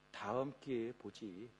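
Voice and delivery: low, soft and slow, letting it go; quiet resignation. Acted. Preacher voicing a man putting off the meeting, quiet resignation. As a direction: murmur it low and unhurried, as if to oneself.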